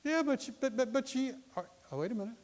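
high-pitched stammered protest; sputtering objector. Acted. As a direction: mimic a doubter stumbling to object.

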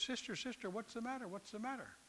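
softly asking a tearful lady what's wrong; gentle concern. Retold or imitated. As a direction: coax a crying woman softly. Hagin gently asks crying lady.

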